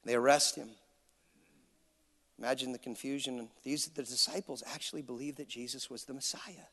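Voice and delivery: hushed sermon storytelling. Acted. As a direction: draw the listeners in quietly. Quiet storytelling of the passion.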